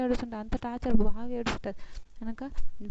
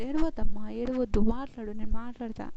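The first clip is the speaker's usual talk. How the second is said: louder urging to stop crying; raised, insistent comfort; retold coaxing; 8 dB louder than usual. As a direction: plead with her to stop and talk.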